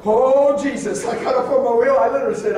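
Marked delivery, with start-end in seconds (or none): 0.0-1.0 a sudden loud cry of alarm; startled cry. Retold or imitated. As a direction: yelp at the car ahead.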